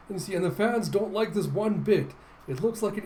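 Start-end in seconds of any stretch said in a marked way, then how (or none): none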